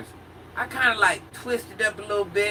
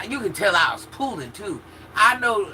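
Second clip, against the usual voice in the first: usual speaking voice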